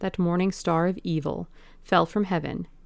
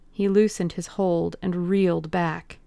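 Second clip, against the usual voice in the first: ordinary delivery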